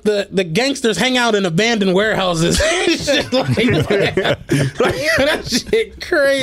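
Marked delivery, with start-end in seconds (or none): 2.6-6.4 laughing through the words, slowed. Real, not acted. Amused by the gangster show, laughing. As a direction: say it while cracking up.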